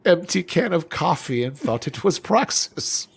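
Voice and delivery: lightly voiced old man; a hint of a doddering old man. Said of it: "this old man voice".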